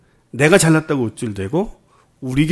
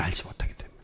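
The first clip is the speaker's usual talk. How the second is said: hushed, pointed whisper; sudden whisper; 7 semitones lower, 16 dB quieter, faster than usual. Real, not acted. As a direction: drop to a whisper for the key point. Preacher drops to make a point.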